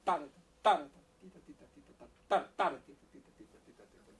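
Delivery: loud, high punches on "Ta", quick light "tita" between; accented rhythm demo. Shown as a demonstration. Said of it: "the accent".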